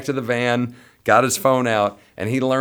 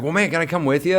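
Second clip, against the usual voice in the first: eager plea; 9 semitones higher, 3 dB louder, faster than usual. Retold or imitated. Luke begging to join trip.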